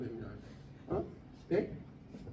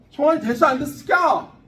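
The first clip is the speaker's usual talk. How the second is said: louder, higher, demanding an answer; loud call-out; 6 semitones higher, 8 dB louder than usual. Real, not acted. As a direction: bark it across the room. Teacher calls out to a student.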